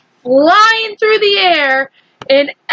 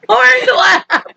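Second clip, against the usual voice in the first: laughing; 11 semitones higher than usual. Real, not acted. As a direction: laugh it out in disbelief.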